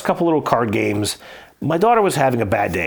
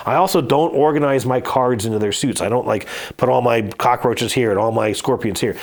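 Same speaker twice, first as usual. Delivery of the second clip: ordinary talk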